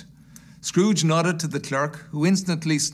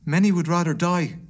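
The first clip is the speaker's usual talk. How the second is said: raised-pitch earnest protest; pleading gentleman; 4 semitones higher, 3 dB louder than usual. Acted. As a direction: appeal on behalf of the poor, voice lifted.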